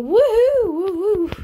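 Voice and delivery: high, ringing whoop; excited woohoo. Real, not acted. Player cheering in her game, high woohoo. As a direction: whoop high, then ease off.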